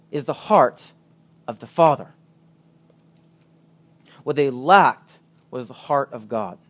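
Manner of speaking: normal talking voice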